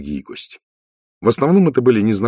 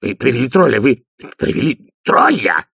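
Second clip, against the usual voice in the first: higher, outraged spluttering repetition; agitated babbling; 5 semitones higher, 4 dB louder than usual. A narrator's reading. Dee appalled at a troll being brought, agitated. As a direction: splutter it, stretching the second take. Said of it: "бормотал в выступлении Ди".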